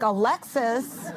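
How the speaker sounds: slow, drawn-out disbelieving echo; incredulous. Retold or imitated. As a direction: repeat it like you can't believe the ask. Incredulous retold reaction to sister.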